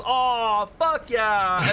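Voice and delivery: loud, high mock cheer. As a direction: whoop it like a rock star. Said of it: "he goes".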